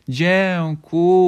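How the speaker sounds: loud, high, drawn-out class chorus; sing-song it slowly like a whole class; children in chorus. A narrator's reading. Pupils thanking their teacher, high drawn-out chorus. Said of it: "odpowiedziały chórem dzieci".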